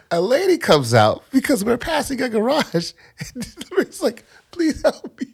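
high, strained telling through laughter; still laughing. Real, not acted. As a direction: fight through the giggles to finish the story. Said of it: "and I'm still laughing".

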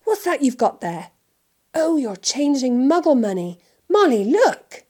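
eager, loud, rising excitement; childlike thrill, building to a high call; delighted. A narrator's reading. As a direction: gush with growing fascination. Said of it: "said Mister Weasley delightedly".

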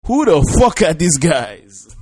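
loud, high, slow outburst; incredulous shout. Acted. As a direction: holler each word in disbelief at the gossip. Shouted reaction to played clip.

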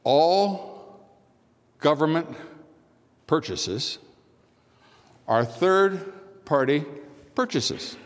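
long dramatic pauses after "All", "government", "purchases" and "third-party"; pauses for emphasis. Shown as a demonstration. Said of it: "now going to pause for emphasis".

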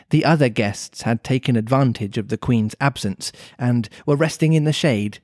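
ordinary delivery

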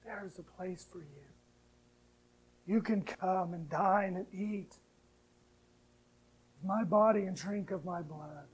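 soft, tender invitation; quiet reassuring Lord; gentle voice of the Lord. Acted. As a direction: hush down as God speaking close. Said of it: "But the Lord is telling you".

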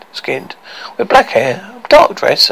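plain reading voice

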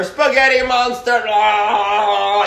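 high, slow hammy wail; silly high cry. Retold or imitated. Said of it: "then start making out".

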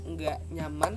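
ordinary talk, nothing marked

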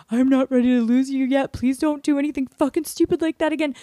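high, pleading mock-crying voice; imitating yourself begging the dog; retold tearful plea. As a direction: plead in a high, thin voice, as if through tears. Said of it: "I just held her and I was like".

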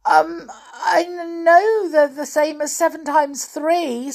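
high, nervous, faltering voice; scared little servant pleading; frightened pixie. A narrator's reading. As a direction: stumble into it, pitched high and anxious. Frightened pixie answers angry giant.

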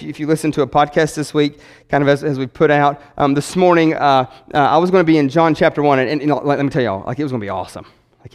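ordinary talk, nothing marked

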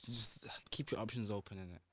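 whispered aside. Real, not acted. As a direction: whisper the advice conspiratorially. Advice suddenly dropped to whisper.

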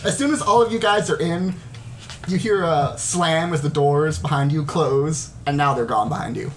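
ordinary talk

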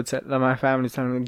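normal talking voice

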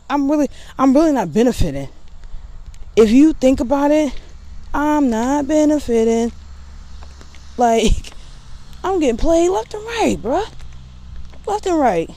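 high-pitched exasperated whine; indignant complaint. Real, not acted. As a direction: vent how unfair it is. Complaining about birthday near Christmas.

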